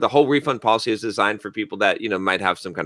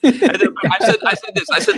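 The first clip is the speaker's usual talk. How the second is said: high, breaking into laughter; can barely get the words out laughing. Real, not acted. Host retelling the call to his cohost, laughing.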